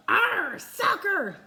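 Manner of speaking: high, loud piratey cry; pirate yell. Acted. Captain kicks the ball, loud pirate yell. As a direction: holler it while kicking. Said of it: "kicked the ball and yelled".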